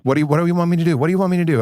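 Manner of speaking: high-pitched, pleading cry for guidance; desperate plea. Retold or imitated. Begging the ayahuasca voice on a hard night, high desperate plea.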